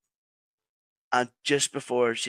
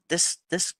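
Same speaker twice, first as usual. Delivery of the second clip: ordinary talk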